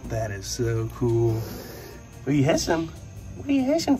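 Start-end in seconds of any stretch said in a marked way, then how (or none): 3.4-4.0 very high teasing pet voice. Real, not acted. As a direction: tease the hissing croc sweetly. Talking to hissing crocodile.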